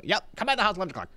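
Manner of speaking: quick, high-pitched take on the mayor; mayor impression. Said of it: "you still got his voice down".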